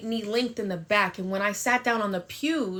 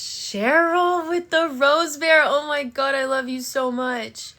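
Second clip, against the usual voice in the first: gushing thanks; 5 semitones higher, 6 dB louder than usual. Real, not acted. Thanking for gift.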